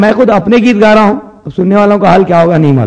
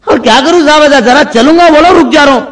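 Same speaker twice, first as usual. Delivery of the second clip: whining patient; 6 semitones higher, 3 dB louder than usual. Acted. Patient complaining.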